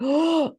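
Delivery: sharp, high imitated gasp; sudden loud intake of shock; horrified gasp. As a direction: gasp in horror, acting out the nurse. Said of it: "like, horrified".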